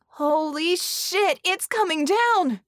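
high, slow, loud panicked cry; shouted warning. Acted. Vix shouting a warning to the others. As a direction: yell it as the roof gives way. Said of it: "Vix shouted a warning".

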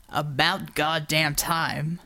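low, slow grumbling rumble; rumbling. Acted. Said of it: "Borovo rumbled".

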